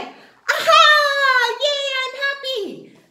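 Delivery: put-on high, loud "Aha, yay" like a delighted kid; happy cheer. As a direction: cheer it out, over the moon. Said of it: "you might make a sound like".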